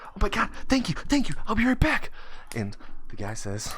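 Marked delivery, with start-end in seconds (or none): 0.1-2.1 very high, gushing thanks; excited kid. Acted. Kid thanks homeless man before running off, overjoyed. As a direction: gush high and loud as if dashing off.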